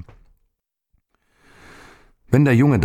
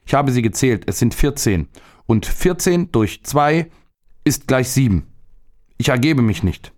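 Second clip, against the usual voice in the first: put-on higher, triumphant boy reckoning it out; boy proudly does his plum sum, higher voice; defiant boy; 6 semitones higher than usual. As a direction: spell out the sum, proud and high. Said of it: "erwiderte der Junge".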